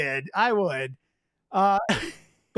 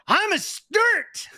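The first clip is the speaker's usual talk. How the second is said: high, slow, proud shout of "I'm a Sturt!"; 12 semitones higher, much slower than usual. Retold or imitated. Drunk Scot proclaiming his clan, slow proud shout. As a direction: declare it, drawn out and proud. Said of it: "he stepped back and shouted".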